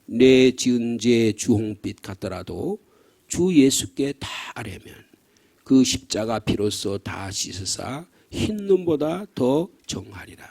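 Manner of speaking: slow, solemn recitation of the hymn verse; hymn lyrics read slowly. Shown as a demonstration. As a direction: read each word slowly and gravely. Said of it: "시작".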